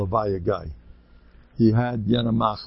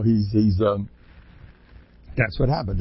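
ordinary talk

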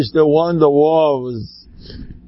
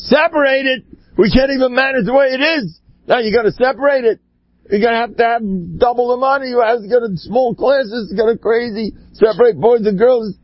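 higher, indignant echo of the advice; imitating flustered objectors, pitched up and quick; incredulous protest; 7 semitones higher than usual. School people objecting to Rabbi Aaron, incredulous protest.

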